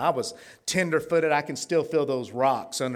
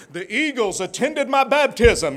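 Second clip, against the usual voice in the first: mock pious; 7 semitones higher, 6 dB louder than usual. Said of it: "Wouldn't it sound spiritual".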